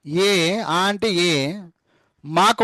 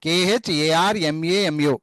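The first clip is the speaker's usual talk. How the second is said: spelling slowly; slower than usual. Shown as a demonstration. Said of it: "ము అంటే MU".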